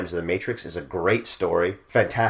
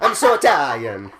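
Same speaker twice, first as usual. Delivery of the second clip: hammed-up Italian accent, slow and louder, laughing through it; slow, laughing mock Italian accent; 5 semitones higher, 6 dB louder, slower than usual. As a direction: ham up a cartoon Italian, slowly, with a laugh. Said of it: "I am a Danny Nucci".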